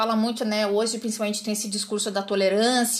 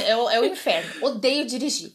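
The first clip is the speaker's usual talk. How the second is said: ordinary talk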